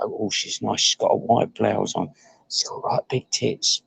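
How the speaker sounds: dropping to a near whisper so she won't hear; hushed aside. Real, not acted. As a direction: lean in and murmur it low. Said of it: "I don't want her overhearing me".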